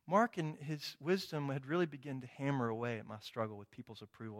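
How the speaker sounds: ordinary talk, nothing marked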